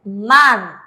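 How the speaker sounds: man said loud and clear as a model reading; teacher models man; syllable man. Shown as a demonstration. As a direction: read out man firmly, like a teacher's demo. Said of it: "aquí esta sílaba vamos a leer".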